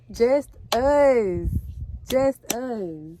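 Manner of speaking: high, loud burst of joy; joyful exclamation. Real, not acted. Mother exulting over the new house for her and daughter, joyful exclamation. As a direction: exclaim it loudly, pitched high. Overjoyed about house for just them.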